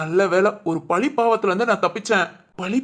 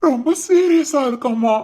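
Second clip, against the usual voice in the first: slow, higher, anguished delivery; distressed; 4 semitones higher, 3 dB louder, slower than usual. Acted. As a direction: draw out the bad news in distress. தற்கொலை முயற்சி செய்தி சொல்லுதல். (வருத்தத்தோடு மெதுவாக)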